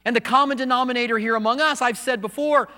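high, loud, driving sermon voice; preaching at full force. Acted. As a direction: build it up and preach it hard.

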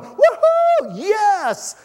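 whooping high and loud cheer; excited cheer. Retold or imitated. Preacher acting out Israel cheering God's victory, whooping. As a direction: throw your head back and whoop yes.

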